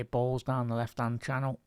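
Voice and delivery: ordinary talk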